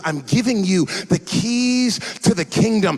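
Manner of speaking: fervent preacher voice; preaching build. Acted. Preaching Jesus's words.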